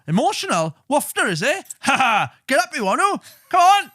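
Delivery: high, loud mocking bellow with a laugh; mock jeering hard man. Mocking dad taunting his crying son, high and loud. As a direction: taunt him, then roar come on. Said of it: "him just be like".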